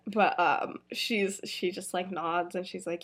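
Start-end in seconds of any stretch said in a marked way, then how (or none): none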